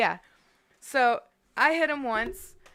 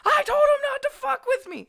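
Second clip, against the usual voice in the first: much higher and louder, yelling in defiance; defiant yell; 8 dB louder than usual. Retold or imitated. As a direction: yell it back at your friend, still fired up. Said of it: "And I'm like".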